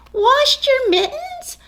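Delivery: a mother thrilled and laughing; delighted laugh. Acted.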